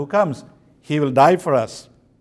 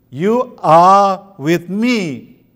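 very slow, louder, leaning on "are"; stress on "are"; 5 dB louder, much slower than usual. Shown as a demonstration. Preacher showing the Bible's emphasised word to believers, stress on "are". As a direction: draw out "are" with deliberate emphasis. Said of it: "wants to emphasize that word".

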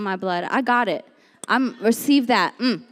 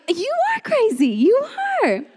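incredulous doubters; 10 semitones higher than usual. Acted. Doubters replying to the believer, high mock disbelief. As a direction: pronounce it in high, comic disbelief.

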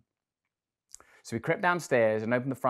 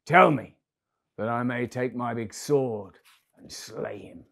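angry giant; 5 dB louder than usual. Acted. Giant vows revenge.

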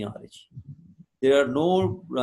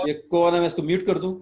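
annoyed; 3 semitones higher, 5 dB louder, much faster than usual. Real, not acted. Teacher annoyed at unmuted mic.

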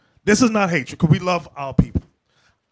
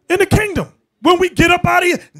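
worked-up preaching; 9 semitones higher, 4 dB louder, faster than usual. Real, not acted. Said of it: "let me calm down".

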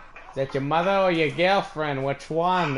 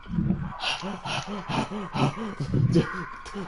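movie gasp; 3 semitones higher, 5 dB quieter than usual. Shown as a demonstration. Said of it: "here's how it would have sounded like if it was a movie".

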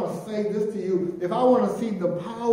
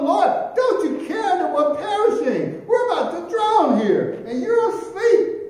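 higher, louder panicked plea of scared disciples; panicked cry; 9 semitones higher, 5 dB louder than usual. Acted. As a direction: cry out to the sleeping master in fear. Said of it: "they're crying out".

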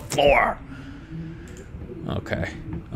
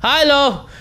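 startled hello; 9 semitones higher, 3 dB louder, slower than usual. Real, not acted. Greets something startling.

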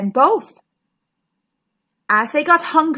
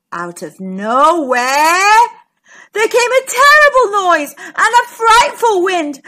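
put-on loud, high, suspenseful storytelling for children; excited storyteller swelling to big emphasis; dramatic story narration; 8 semitones higher, 7 dB louder than usual. A narrator's reading. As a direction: build the drama of a sudden storm.

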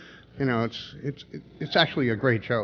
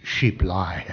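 deadpan punchline; 5 semitones lower than usual. Acted. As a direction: lower deadpan Indian voice. Joke punchline, Indian voice.

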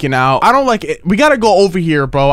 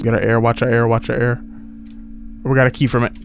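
usual speaking voice

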